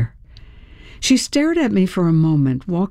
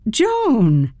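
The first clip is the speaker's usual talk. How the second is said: high, falling call of surprise; surprised; 7 semitones higher than usual. A narrator's reading. Homeowner to an unexpected woman in the driveway, surprised greeting. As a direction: greet an unexpected visitor in a raised pitch. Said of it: "I was surprised to see her".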